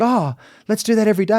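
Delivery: high, fast and eager; delighted. Retold or imitated. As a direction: gush with delight. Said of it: "and she said".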